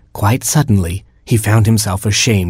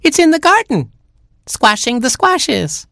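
very high chick voice, slowing at the end; Baby Chick. A narrator's reading. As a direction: squeak it, drawing out the last phrase. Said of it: "said Baby Chick".

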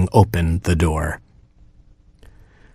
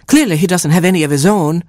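high, huffy mother hen; squawking hen; 10 semitones higher, faster than usual. A narrator's reading. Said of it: "squawked Mama Hen".

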